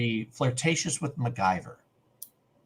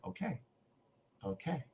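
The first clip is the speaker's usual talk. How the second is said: very soft, slightly raised okays; retold meek acceptance; 4 semitones higher, 15 dB quieter than usual. Fan acting Pete's reply to a maybe, very softly. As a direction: imitate someone quietly giving in.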